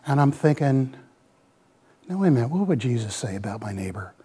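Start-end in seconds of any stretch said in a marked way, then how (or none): 2.1-4.1 a quick, soft whisper to himself; whispered inner thought. Retold or imitated. Thinking to himself about his neighbor, quick soft whisper. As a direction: hush it out fast, thinking aloud.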